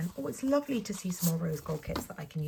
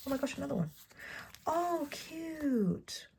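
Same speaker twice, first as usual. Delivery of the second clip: much higher, thrilled squeal; delighted; 9 semitones higher than usual. Real, not acted. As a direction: gush over something adorable. Delighted at another sticker kit.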